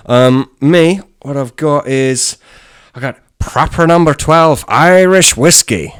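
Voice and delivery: put-on Irish accent, high, loud and slow; Irish brag, slow and loud; an Irish accent on his drinks boast; Conor McGregor. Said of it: "Sounded just like Conor McGregor".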